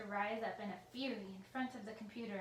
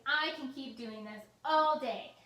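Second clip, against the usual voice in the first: furious rant; 3 semitones higher, 7 dB louder than usual. A narrator's reading. Villain threatening the computer, loud furious rant.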